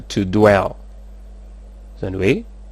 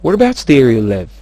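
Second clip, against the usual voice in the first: rapid delivery; 3 semitones higher, 6 dB louder than usual. Shown as a demonstration. Teacher models the question with rapidity, words run together. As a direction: run together quickly, loud, falling tune. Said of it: "tsy maintsy miaraka amin'ny rapidité foana".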